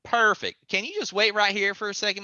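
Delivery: a hint of a busy mom, brisk; mom, chipper. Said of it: "She goes".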